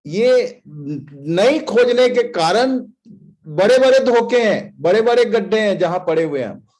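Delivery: fervent preaching. Real, not acted. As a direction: warn the congregation, loud and urgent. Preaches with force. (Pulpit से मंडली को चेतावनी देते हुए, जोशीला उपदेश)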